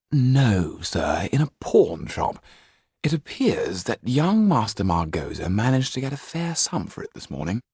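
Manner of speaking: a hint of the proper valet reporting; lightly voiced valet. Valet telling his master where the coat was found, lightly voiced.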